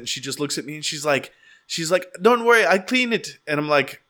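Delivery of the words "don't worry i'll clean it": put-on very high, louder Jamaican accent; breezy reassurance in a Jamaican accent. Wife calmly telling the roommate not to worry, in a Jamaican accent. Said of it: "she was Jamaican, so she had an accent".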